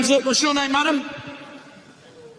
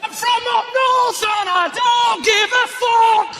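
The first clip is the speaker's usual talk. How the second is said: high, slow, drawn-out yell; hammed-up brazen northern holler; mock defiant shout; 14 semitones higher, 5 dB louder, slower than usual. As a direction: bellow it proudly, stretching every word. Imitates brazen northern woman shouting.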